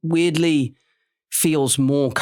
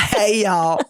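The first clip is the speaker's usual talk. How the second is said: laughing mock Southern greeting; Deep South accent; 5 semitones higher than usual. Actor joining the tease, Southern accent. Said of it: "that is the Deep South".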